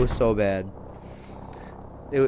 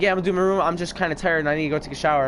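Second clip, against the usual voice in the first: hurried, higher-pitched rambling answer; super-quick replies; 4 semitones higher, 3 dB louder, much faster than usual. Retold or imitated. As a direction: rush the excuse out before she's finished. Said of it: "he started responding super quick to everything she said".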